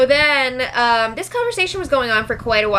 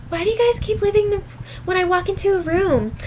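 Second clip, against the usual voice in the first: high indignant mock whine; 9 semitones higher, faster than usual. Retelling Bridget calling out Zakiya.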